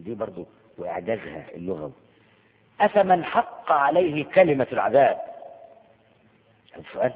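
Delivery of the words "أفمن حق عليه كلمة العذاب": slow, louder chanted verse. Acted. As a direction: intone it like a reciter. Recites verse.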